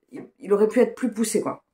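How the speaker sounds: ordinary talk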